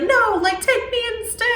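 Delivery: high-pitched mock plea; pleading kid. Kid offers himself to Krampus, hammed-up pleading. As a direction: imitate a desperate kid, voice jumping high.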